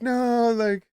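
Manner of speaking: high, loud cartoon yell; mock shout. Cartoon Lowry running after DeRozan, high mock yell. Lowry runs down protesting trade.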